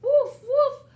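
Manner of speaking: loud barked woof like a puppy; loud playful puppy woofs; dog bark. Acted. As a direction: bark it out like Biscuit. Dog barks in story.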